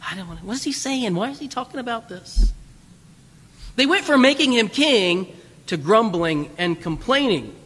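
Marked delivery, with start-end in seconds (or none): 0.1-2.5 mock grumbled mutter under the breath; half-hidden mock muttering among the crowd. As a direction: mutter it like a crowd complaining to each other. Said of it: "it means to mutter, to whisper among one another".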